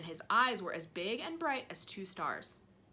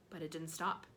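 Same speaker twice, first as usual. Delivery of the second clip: ordinary delivery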